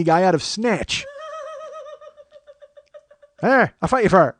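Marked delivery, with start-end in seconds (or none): 3.4-4.3 Brad Pitt. Pretend.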